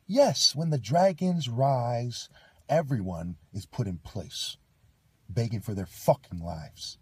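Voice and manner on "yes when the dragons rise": slow, loud, high proclamation; proclaiming. Real, not acted. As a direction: drag it out like a prophecy. Preaching about dragons rising.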